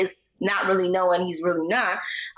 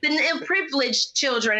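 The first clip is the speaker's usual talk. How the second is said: mocking Lauren; 6 semitones higher than usual.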